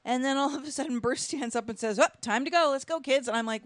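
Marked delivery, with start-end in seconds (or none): 2.0-3.3 higher and louder, brisk announcement; abrupt loud exit call. Retold or imitated. Husband at Easter dinner abruptly telling the kids to leave, loud and brisk. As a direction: retell him hustling everyone out, much louder.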